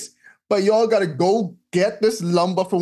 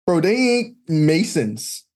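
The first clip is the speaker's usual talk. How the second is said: ordinary talk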